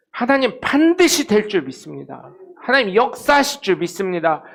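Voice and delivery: normal talking voice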